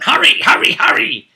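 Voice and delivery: mock-urge the party to rush up; urgent hurry. GM contrasting caution with a player hurrying everyone, high urgent cry. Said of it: "you know, rather than".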